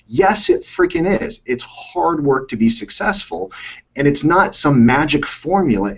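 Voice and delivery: slow, weighty emphasis; real coach insisting slowly; emphatic slowdown. Real, not acted. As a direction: drive the point home slowly. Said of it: "emphatic slow lecture".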